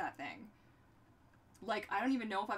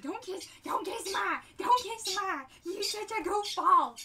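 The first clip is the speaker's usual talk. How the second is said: louder, slower, laughing protest; playful loud protest; 8 semitones higher, 10 dB louder, slower than usual. Real, not acted. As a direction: push the dog away playfully. Fending off the dog playfully.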